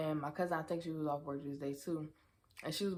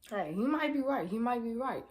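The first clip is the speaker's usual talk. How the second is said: ordinary talk, nothing marked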